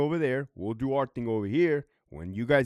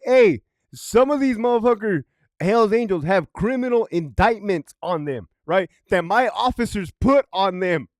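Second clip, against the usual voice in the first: louder, higher insistent point; insistent chief; 5 semitones higher, 6 dB louder than usual. Retold or imitated. Police chief pressing his case about indictments, loud. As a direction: hammer home the indictments.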